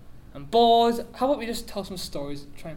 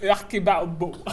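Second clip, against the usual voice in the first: ordinary delivery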